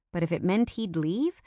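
ordinary delivery, nothing marked

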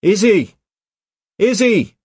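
high, loud urgent call; yelling. A narrator's reading. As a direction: yell her name from a distance. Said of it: "he yelled".

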